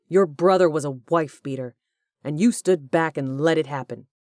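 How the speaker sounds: loud, furious accusation; angry accusation. A narrator's reading. Corbin accusing Hugh of letting abuse happen, loud and furious. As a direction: hurl the blame at him.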